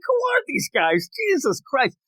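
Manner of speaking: high-pitched exasperated reaction; mock disbelief. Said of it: "I'm like".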